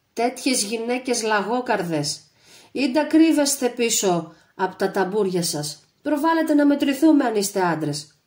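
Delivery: loud, high jeering challenge; mock the cowards to come out; taunting shout. A narrator's reading. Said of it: "προκαλούσε τους Βουλγάρους φωναχτά". (δυνατή, προκλητική φωνή)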